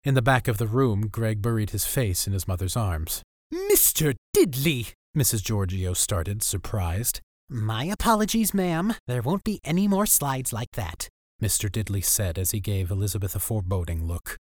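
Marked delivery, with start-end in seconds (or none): 3.5-4.9 higher, slow outraged mom; indignant mother. Acted. 7.5-11.1 whiny teacher voice. Acted. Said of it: "whiny voice".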